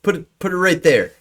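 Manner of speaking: put-on defensive child giving orders; wary little boy. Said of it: "he points the sword down at the ground and he goes".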